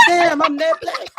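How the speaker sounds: high, loud cracked-up reaction; helpless laughing outcry; laughing disbelief. Retold or imitated. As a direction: burst out laughing through the words. Cracking up at own punchline.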